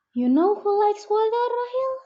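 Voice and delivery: put-on high, bright voice for a baby; playful sing-song. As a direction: tease the baby brother playfully. Sister teasing baby brother.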